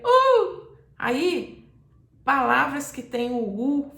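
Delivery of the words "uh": "uh" agudo e forte, como chamado ouvido de longe; grito de U. Shown as a demonstration. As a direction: solte só a vogal tônica gritada. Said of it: "aí só sai". (grito agudo de "u" sustentado)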